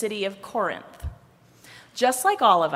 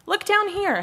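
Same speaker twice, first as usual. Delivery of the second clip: high-pitched storybook call; 12 semitones higher, 3 dB louder than usual. A narrator's reading. Head calls up to Arms in the tree, calling up. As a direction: call up to someone above you.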